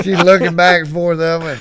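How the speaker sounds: ordinary talk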